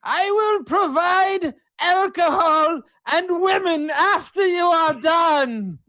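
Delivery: high, declaimed proclamation; emphatic promise. Acted. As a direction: proclaim it to win over the crowd. Player character makes rallying promise.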